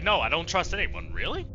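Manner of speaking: usual speaking voice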